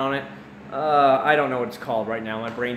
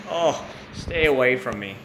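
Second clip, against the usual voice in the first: mock recoil; slower than usual. Avoiding crowds of teen tourists.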